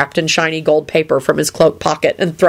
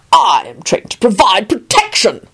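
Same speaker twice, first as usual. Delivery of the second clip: slow, higher pompous declaration; supercilious; 4 semitones higher than usual. A narrator's reading. Guard boasts to Lupin, supercilious. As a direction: announce it slowly with self-importance. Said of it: "the man said superciliously".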